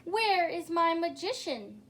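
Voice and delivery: big booming royal call; a king booming for his magician. A narrator's reading. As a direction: boom it out like a king. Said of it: "boomed the king".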